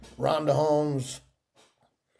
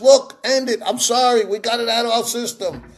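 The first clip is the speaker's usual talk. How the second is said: higher, louder plea to stop; pleading apology; 8 semitones higher, 3 dB louder than usual. Retold or imitated. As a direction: beg him to end it. Ronald begs to end fight.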